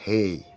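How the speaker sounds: "hey" said as English A, "ay", loud. Shown as a demonstration. Teacher contrasts English A after French. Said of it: "hey en anglais".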